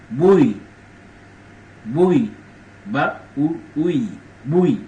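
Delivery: bui broken into ba u ui, the first bui loud; syllable-by-syllable demo. Shown as a demonstration. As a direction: sound out bui clearly for learners. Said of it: "Bui mean".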